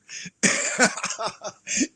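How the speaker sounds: high breathy retold laugh; high, airy mocking cackle. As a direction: laugh derisively at a beaten opponent. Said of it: "I just laughed at him".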